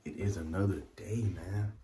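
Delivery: whispering. Real, not acted. Vlogger to viewers near mama's room, whispering. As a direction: keep it down, nearly whispered. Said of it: "I'm whispering, 'cause look".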